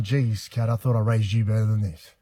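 mum's scolding. Retold or imitated. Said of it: "that Mum's gone".

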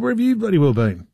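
a mild retold snap, pitch up; snapping at wife. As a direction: demand it sharply, like an annoyed husband. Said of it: "I did say it like that".

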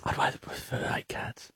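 whispered retort, barely any voice. Real, not acted. Co-host answers the stuffed koala remark, whispered. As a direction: whisper it as an aside.

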